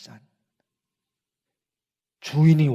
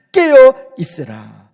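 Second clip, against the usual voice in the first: urgent command; 5 semitones higher, 10 dB louder, slower than usual. Acted. Final urgent Jesus command.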